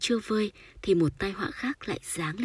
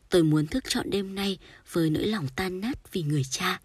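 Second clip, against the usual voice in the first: ordinary delivery, nothing marked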